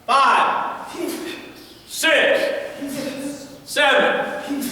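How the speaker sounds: loud, high counts shouted out; called drill counts. Real, not acted. Coach counts punches for the class, loud high calls. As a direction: bark each number separately.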